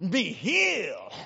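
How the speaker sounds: slow, stretched faith-healer command; drawn-out Southern preacher cry; Ernest Angley. Retold or imitated. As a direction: imitate a TV healer's dramatic pronouncement. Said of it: "and he would go".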